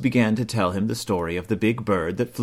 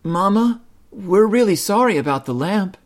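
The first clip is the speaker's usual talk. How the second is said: high, louder apologetic cub; sorry cub; 10 semitones higher, 4 dB louder than usual. A narrator's reading. Brother Bear apologizing to Mama, sorry. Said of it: "said brother".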